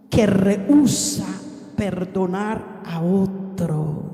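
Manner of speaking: slow, stretched-out pulpit delivery; weighty, measured sermon cadence; deliberate preaching. Real, not acted. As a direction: draw out each key word for the congregation. Preaches definition slowly.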